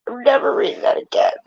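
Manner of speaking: real crying, rushed. Real, not acted. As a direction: blurt it out through sobs. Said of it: "It's so sad".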